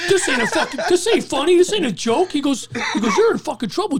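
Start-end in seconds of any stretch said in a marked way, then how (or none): 0.1-2.3 high, loud, indignant telling-off; mock outrage, pushed up in pitch; retold angry scolding. Cook scolds him for joking. 3.2-4.0 raised, exasperated warning; mock alarm, higher in pitch; retold stern warning. Cook warns him of trouble.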